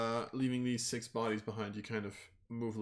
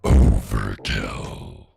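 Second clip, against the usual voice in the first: booming, very low, drawn-out announcer of doom; dark deep shout; 17 dB louder, much slower than usual. Acted. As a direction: shout it out slowly in a huge deep voice. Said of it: "hear this dark, deep voice shout out".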